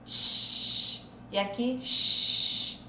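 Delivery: loud hushed sh, then slow; sh sound. Shown as a demonstration. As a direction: hold the sh sound. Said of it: "Então, C H". (som de sh)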